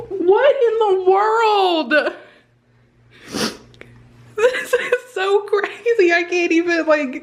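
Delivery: high, slow, disbelieving exclamations; stunned. Real, not acted. Reacting to the positive test, high and stunned. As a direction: voice climbs high in real shock.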